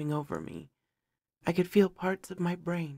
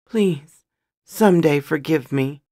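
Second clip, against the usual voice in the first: loud, acted plea for forgiveness; urgent, emotional begging in a read-aloud; shaking voice; 10 dB louder than usual. A narrator's reading. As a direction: plead with sudden force, as a narrator voicing distress. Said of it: "his voice shaking".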